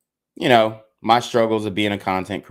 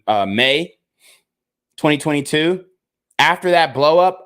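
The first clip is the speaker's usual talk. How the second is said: usual speaking voice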